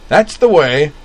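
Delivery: loud high cheering; excited cheer. Acted. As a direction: cheer on the fighter. Cheering fan at hockey fight.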